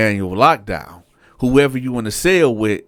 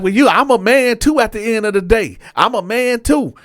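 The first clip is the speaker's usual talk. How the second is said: high, loud standing his ground; loud insistence; 11 semitones higher, 4 dB louder than usual. Retold or imitated. Cellmate insisting to Dante that he's a man too, high and loud. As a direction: insist on your dignity. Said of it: "He like".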